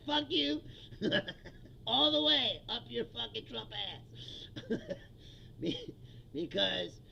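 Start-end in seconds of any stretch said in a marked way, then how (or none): 0.1-3.7 laughing insult. Real, not acted. Host telling off pissy Trump fans, laughing defiantly. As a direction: curse them through real laughter. Gleeful swearing at critics, laughing.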